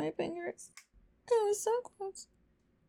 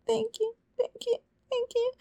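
breathless high thanks; 8 semitones higher than usual. Acted.

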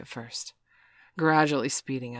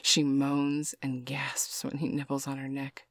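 ordinary delivery, nothing marked

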